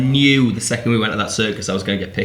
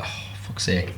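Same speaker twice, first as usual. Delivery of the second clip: muttered curse; 4 semitones lower, 10 dB quieter than usual. Retold or imitated. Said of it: "I'm like".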